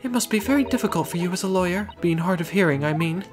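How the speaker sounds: high-pitched refined lady. Acted. Client talks to lawyer.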